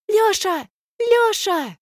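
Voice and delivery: high piercing shriek. Acted. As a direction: shriek his name. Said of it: "верещит Алька".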